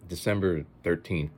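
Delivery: ordinary talk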